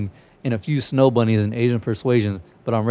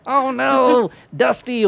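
much higher, shrill-free mock panic of the woman filming; panicked cat owner; 12 semitones higher, much slower than usual. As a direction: imitate the lady recording her cat, high and desperate. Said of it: "the lady recording was like".

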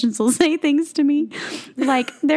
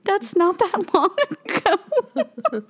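laughing dismay; 5 semitones higher, much slower than usual. Retold or imitated. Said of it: "I was like".

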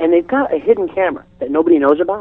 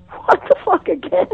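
very high-pitched baffled squeak; hammed-up incredulous question; exasperated disbelief; 3 dB quieter than usual. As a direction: send it way up in bewilderment. Baffled reaction to second script surprise.

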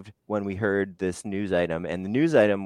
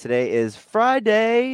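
excited announcer; 11 semitones higher, 6 dB louder than usual. Acted. Announcing the day, Friday stretched.

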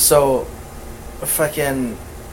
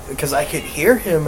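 usual speaking voice

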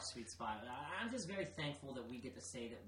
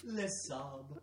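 high fake French lament; hammed-up French heartbreak through laughs; mock French sobbing; 5 semitones higher than usual. Joking fake French mourning.

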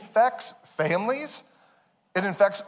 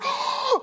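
mock gasp of amazement; amazed gasp. Kids hearing amazing news, amazed gasp. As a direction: gasp like your mind just exploded. Kids' minds blown by news.

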